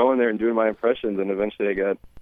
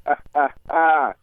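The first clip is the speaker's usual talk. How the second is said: Denzel Washington; 4 semitones higher than usual. Acted. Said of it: "Denzel Washington".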